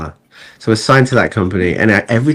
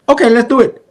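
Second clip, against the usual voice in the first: put-on higher, louder Mexican accent, eager agreement; a hint of Mexican accent, upbeat and ready; 6 dB louder than usual. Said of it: "but I can do the accent for you".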